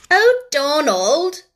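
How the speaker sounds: high, loud, drawn-out dismayed cry; older sister's alarmed exclamation. A narrator's reading. Sister scolding her little brother, alarmed. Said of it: "Janet exclaimed alarmedly".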